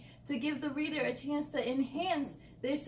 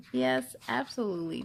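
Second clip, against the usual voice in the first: ordinary delivery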